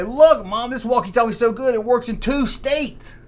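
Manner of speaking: a hint of a bragging child; excited kid. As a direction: boast to Mom like a kid. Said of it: "the kids will say".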